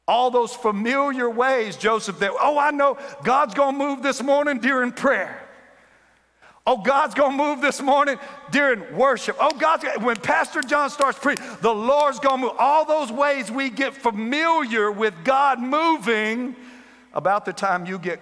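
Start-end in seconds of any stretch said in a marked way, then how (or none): 2.4-12.5 high loud churchy certainty; eager expectation. Acted. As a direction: preach the expectation.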